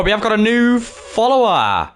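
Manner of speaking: high, ringing follower shout-out; excited announcement. Real, not acted. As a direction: announce it big, stretching the last word. Announces a new follower on stream.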